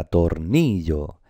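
tornillo slowly, with NI stretched; stress on NI. Shown as a demonstration. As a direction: lean long on the NI of tornillo. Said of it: "el acento está en esta".

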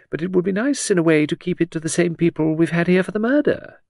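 lilting high society lady; chattering aristocrat. Acted. Hostess prattling to Edward about the wedding. Lucy musing about the best man.

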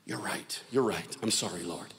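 low, soft, chastened admission; acted humbled murmur; sheepish apology. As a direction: back down quietly, conceding the point. Man backs down and apologises.

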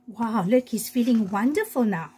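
plain reading voice

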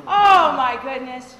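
slow, loud laughing exclamation; laughing oh my goodness. Real, not acted. Speaker laughs at the audience's harsh answer. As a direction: laugh it out, amused and a little shocked.

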